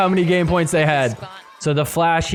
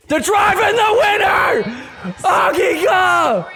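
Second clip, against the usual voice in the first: wild championship call; ecstatic shout; 10 semitones higher than usual. Real, not acted. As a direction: scream the win for the champions. Championship-winning point call.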